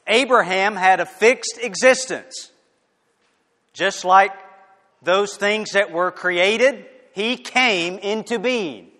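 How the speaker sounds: loud, slowed, fervent pulpit delivery; preaching at full force. Acted. Preacher proclaiming to the church, loud and fervent. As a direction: thunder it out to the congregation.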